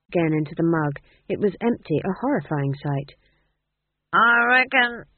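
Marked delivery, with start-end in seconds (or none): 4.1-5.0 put-on slow, high drunken drawl; drunk, concentrating. A narrator's reading. Drunk dwarf considering the question, slow and slurred. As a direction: drag it out, concentrating hard through the drink. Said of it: "he tried again".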